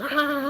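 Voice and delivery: sudden high yelp of a cop; mock cop yell. Retelling the cop yelling after him as he runs, high yelp. Officer yells as teen runs.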